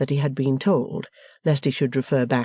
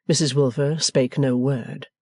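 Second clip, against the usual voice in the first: ordinary delivery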